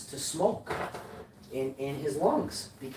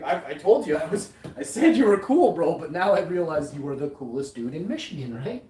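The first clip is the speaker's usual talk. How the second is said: excited; 4 semitones higher, 7 dB louder than usual. Retold or imitated. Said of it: "I'm like".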